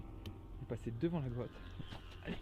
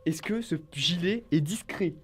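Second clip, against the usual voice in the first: mocking jab; 3 semitones higher, 8 dB louder than usual. Real, not acted. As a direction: tease him about the vest. Mocking the bright vest.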